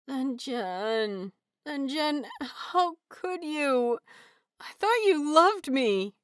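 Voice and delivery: high, whining mock despair; hammed-up betrayed whine, slow on how could you; pitiful moan. A narrator's reading. Wei Ying playfully moaning at his husband, high mock betrayal. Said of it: "Wei Ying's voice is a pitiful moan".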